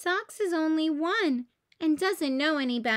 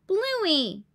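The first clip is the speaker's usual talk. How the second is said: dad scolding; 5 semitones higher, 5 dB louder than usual. Acted. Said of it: "scolds Dad".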